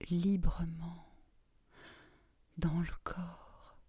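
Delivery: soft, near-whispered hypnosis voice with long pauses; guided relaxation. Real, not acted. Guide leading listeners through a body scan to relax, hushed meditation voice.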